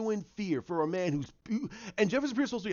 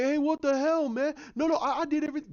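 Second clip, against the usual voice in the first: much higher, louder panicked protest; mock panicked protest; 10 semitones higher, 3 dB louder, faster than usual. Fan acting out the scared character, high panicked protest. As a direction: plead your innocence in a high voice.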